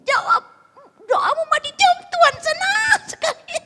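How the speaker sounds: high-pitched, loud jubilation; overjoyed shouting. Acted. Husband telling his wife her prayer was answered, high jubilant shouting. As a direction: squeal the good news at full volume.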